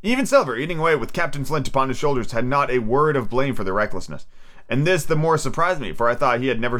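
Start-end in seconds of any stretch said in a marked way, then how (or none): none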